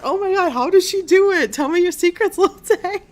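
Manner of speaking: high, loud squealing fan laughing; gushing fan voice. Retold or imitated. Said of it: "And people were like".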